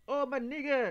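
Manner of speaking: loud, high, mock street greeting; imitating a kid's greeting. A Moroccan kid greeting him on the street, loud and high. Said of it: "and he said".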